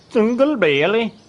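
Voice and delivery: "Single Bailey" in a high, loud mock German accent; a bad German accent on "Single Bailey"; attempted German accent. Streamer tries chat's German accent request, mock German accent. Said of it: "Pronounce it with a thick German accent".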